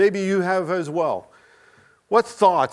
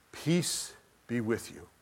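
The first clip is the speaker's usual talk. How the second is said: soft, slow and loving; a quiet, gracious welcome; gentle greeting; 5 semitones lower, 11 dB quieter than usual. Acted. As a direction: greet an old friend tenderly. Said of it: "He greets him cheerfully".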